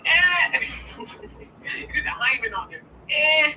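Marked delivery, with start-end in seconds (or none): 0.0-0.6 dismissive eh. Retold or imitated. Dismissive grunt at someone's request. 3.1-3.5 dismissive eh. Retold or imitated. Repeats dismissive grunt.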